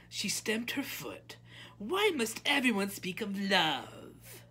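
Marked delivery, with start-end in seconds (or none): 1.8-4.4 high, loud, exasperated princess; petulant royal outburst. A narrator's reading. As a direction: complain like a spoiled princess stamping. Princess stamps her foot.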